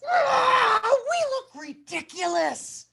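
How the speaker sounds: high, loud shouted dismay; dismayed animals. Acted. Animals shout in dismay at their looks, high and loud. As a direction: cry out at how silly they look. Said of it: "all looked at each other and said".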